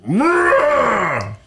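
loud mock growl, high; creature growl. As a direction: growl like a zombie into a belly. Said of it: "It was like".

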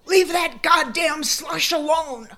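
high, loud furious yell; grandma yelling at the shovelers, furious. Retold or imitated. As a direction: bellow it out the front door. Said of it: "and yelled out".

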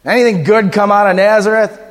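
put-on grumpy old skeptic scoffing at Nazareth; grumpy old guy. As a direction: arms crossed, sneer at the idea. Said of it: "one of those guys with their arm crossed with that sour face".

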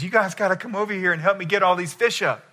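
lightly acted call to another boat. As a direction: call over for help, pitch lifted. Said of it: "he calls out to another boat".

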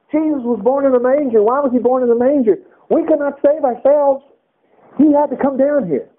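lifted, impassioned sermon delivery; fervent preaching. Real, not acted. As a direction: preach it with conviction. Preaching at full force.

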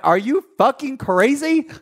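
high, drawn-out retold disbelief; slow, incredulous comeback; incredulous outburst. Retold comeback to boyfriend's accusations.

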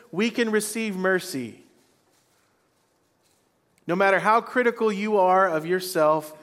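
fervent preaching. Acted. Pastor urging the congregation to accept mercy, preaching at full force.

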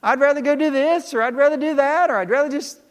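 high, loud preacher's fervor; full-force preaching. Acted. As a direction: preach it out big to the room.

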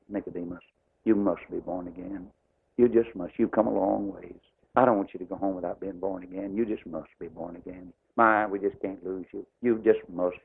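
soft, tender plea to Nicodemus, "you must be born again" said gently; tender pleading. Shown as a demonstration. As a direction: plead softly, like you can't bear to lose him. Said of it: "I felt the pathos of Jesus".

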